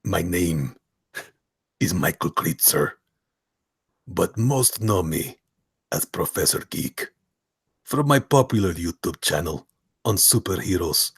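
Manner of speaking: slow, measured put-on voice; character voice with long pauses; Professor Geek. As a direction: introduce yourself in halting, deliberate phrases. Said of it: "I am now inserting a voice to Professor Geek".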